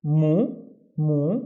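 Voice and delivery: MU sounded out alone; careful teacher's "mu"; isolated syllable. Shown as a demonstration. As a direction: say "mu" by itself, pause, repeat. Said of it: "read them one syllable at a time".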